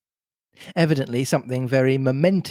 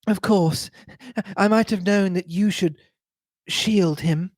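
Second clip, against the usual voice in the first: higher-pitched, bitter reproach; rising bitterness; 6 semitones higher than usual. A narrator's reading. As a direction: let the bitterness build. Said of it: "replied with a rising bitterness".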